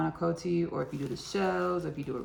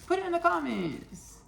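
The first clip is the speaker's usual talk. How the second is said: much higher, falling sing-song put it in the comments; high sing-song repeat; 11 semitones higher than usual. Shown as a demonstration. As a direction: toss it up high like a playful nudge.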